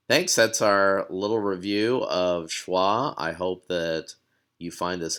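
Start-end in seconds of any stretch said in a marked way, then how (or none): none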